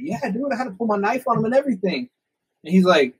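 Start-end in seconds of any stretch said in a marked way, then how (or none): 0.0-2.1 excited retelling. Retold or imitated. Excitedly tells Cody about robbery.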